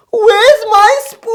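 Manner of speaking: high, slow, strained squeak; mock big lady. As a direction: stretch it out in a silly high squeal. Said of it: "she goes".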